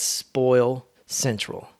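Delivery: very slow Spoil Central; slow naming. Real, not acted. Repeating the nickname for the grandparents' house, slowly. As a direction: linger on each word of Spoil Central. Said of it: "That's what I call".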